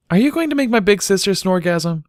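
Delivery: put-on high, loud innocent child voice; stern little filly. A narrator's reading. Little filly sternly questioning Bucky. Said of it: "looking sternly".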